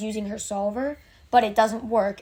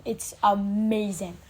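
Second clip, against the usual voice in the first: normal talking voice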